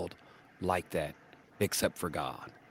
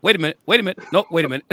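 put-on loud, higher kid protest; oldest boy objecting; 8 semitones higher, 10 dB louder, much faster than usual. Oldest grandson catching grandpa's trick, loud protest. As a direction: stop everyone like a boy who caught the trick. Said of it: "Oldest boy said".

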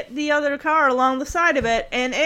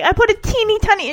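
minimizing daughter; 9 semitones higher, 5 dB louder, much faster than usual. Retold or imitated. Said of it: "She's like".

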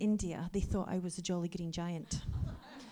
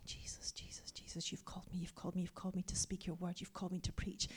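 soft, urgent whispered plea; a desperate private prayer whispered to Jesus; 11 dB quieter than usual. Retold or imitated. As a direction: breathe the prayer under your breath.

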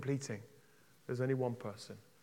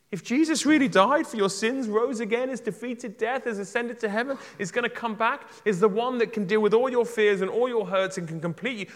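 higher and louder, urgent preaching climax; preaching at full force; 8 semitones higher, 9 dB louder than usual. Real, not acted. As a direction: press the appeal with rising fervour.

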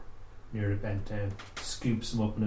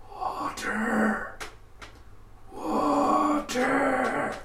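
much higher, rasping croak of a dead man; raspy corpse voice; 4 dB louder, much slower than usual. Acted. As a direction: rasp it out like a parched corpse begging. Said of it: "in this raspy, horrible voice".

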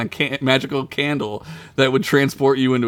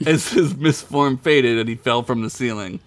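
ordinary delivery

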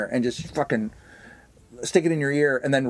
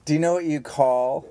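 slow joke setup; 4 semitones lower than usual. Acted. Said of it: "Nine trucks drove through that fucking pause".

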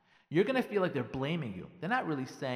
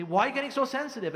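exasperated; 7 semitones higher, 6 dB louder than usual. Acted. Husband voiced counterattacking in quarrel.

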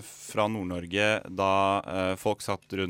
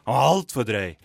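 shocked protest; 3 semitones higher, 8 dB louder than usual. Retold or imitated. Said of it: "og så var det sånn".

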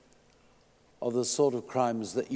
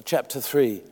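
usual speaking voice

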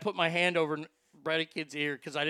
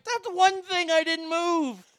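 exasperated disbelief; 12 semitones higher, 7 dB louder than usual. Retold or imitated. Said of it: "And I'm like".

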